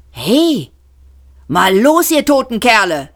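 brash partygoer calling out. Acted. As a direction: rouse the dead men. Zaphod calls out.

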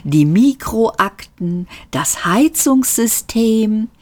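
slow, high, sugary lift voice; honeyed. A narrator's reading. Lift lists other places to go, honey-sweet. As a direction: draw each item out like dripping honey. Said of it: "wie Honig auf ein Brötchen".

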